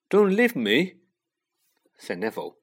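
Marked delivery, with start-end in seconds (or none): 0.1-1.0 high, loud pleading; alarmed, raised-voice appeal; anxious plea. A narrator's reading. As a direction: beg them to stay. Neville pleads not to be left.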